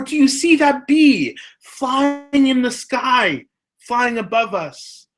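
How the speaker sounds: put-on high, slow, wide-eyed kid wonder; drawn-out words in a high child's voice; amazed young child. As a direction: point it out like an awestruck small child. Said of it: "the child will turn and say".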